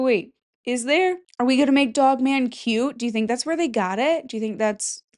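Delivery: usual speaking voice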